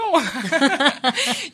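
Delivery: high delighted laugh bursting out; bright high laugh. Real, not acted. Reporter laughing at how moved she was, high laugh. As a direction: laugh out loud at yourself. Laughing at her own emotion.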